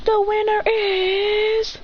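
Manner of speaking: high, very slow winner announcement; high drawn-out referee call; announcer call. Acted. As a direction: announce it to the crowd. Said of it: "He says".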